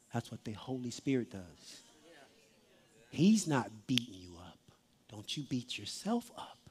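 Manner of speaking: low, very soft sermon delivery; intimate, subdued preacher; hushed preaching. Real, not acted. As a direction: drop down low and comfort the congregation quietly.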